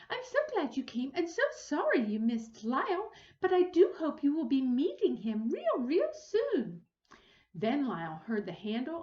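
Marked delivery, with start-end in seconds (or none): 0.1-6.8 lightly voiced lady. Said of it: "and Missus Prim say".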